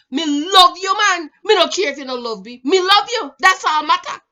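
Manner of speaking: put-on loud, high Jamaican accent, declaring love stubbornly; Jamaican man impression. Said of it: "That's how they talk".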